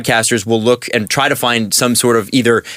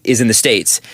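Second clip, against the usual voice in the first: normal talking voice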